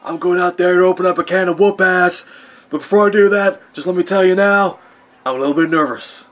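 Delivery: loud, high, hammed-up tough-guy voice turning timid; mock Stone Cold shouting, then admitting nerves; Stone Cold impression. Vlogger imagines Stone Cold Steve Austin cutting a nervous promo, hammed-up shout. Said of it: "could you imagine Stone Cold Steve Austin going".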